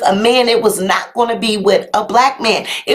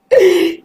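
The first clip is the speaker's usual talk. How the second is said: high giggle after a silly song; laughter; 6 semitones higher than usual. Real, not acted. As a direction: burst out giggling. Laughs at her own song.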